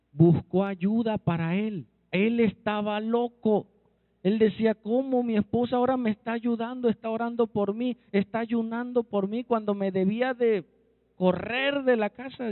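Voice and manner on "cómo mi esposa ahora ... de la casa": high-pitched imitation of a bewildered husband; acting out incredulity in a raised voice; retold disbelief. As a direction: retell his astonished question, pitched up.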